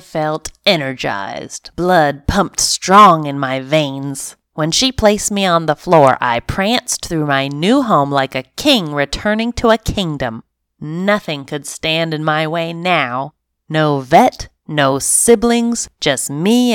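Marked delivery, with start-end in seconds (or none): none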